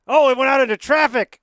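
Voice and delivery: high, very loud commentator's yell; excited outcry. Real, not acted. Commentator watching the ball fly into traffic, loud excited outcry. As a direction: cry out in alarm as the ball escapes.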